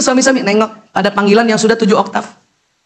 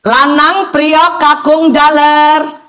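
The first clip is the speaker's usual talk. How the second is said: seven-octave call; 3 semitones higher, much slower than usual. Retold or imitated. Mother's third call to her gaming sons, slow and drawn-out. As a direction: stretch each name out like a mother who has had enough. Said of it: "tujuh oktav".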